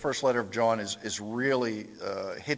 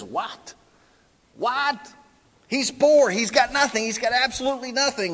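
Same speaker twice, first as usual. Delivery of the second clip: much higher, loud shocked wife; shocked Golda; 11 semitones higher, 7 dB louder than usual. Retold or imitated. Golda objects to the poor suitor, high and loud. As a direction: shriek it out in outrage. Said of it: "And she says".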